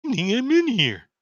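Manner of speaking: high, loud little creature voice; Lulu character voice. Acted. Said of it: "Lulu's like".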